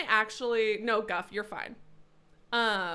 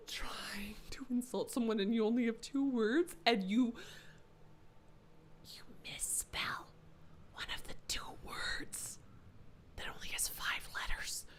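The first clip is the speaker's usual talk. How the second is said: whispered mockery; 8 dB quieter than usual. Real, not acted.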